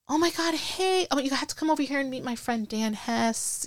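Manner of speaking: high, eager "oh my God, hey" rushing on; a friend thrilled to see you; gushing welcome. Retold or imitated. Friend excitedly welcoming her.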